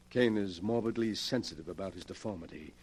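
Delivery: ordinary talk, nothing marked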